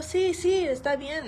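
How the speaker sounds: high, eager agreement; excited sí, sí; eager yes. Retold or imitated. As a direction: chirp a quick happy yes. Eager reply to receptionist.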